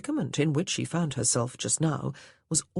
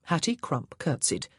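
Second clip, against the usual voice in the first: usual speaking voice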